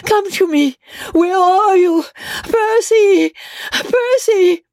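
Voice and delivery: high, desperate calling out; hysterical shriek. A narrator's reading. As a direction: shriek it, torn between doubt and hope. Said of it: "she shrieked hysterically, tortured between doubt and hope".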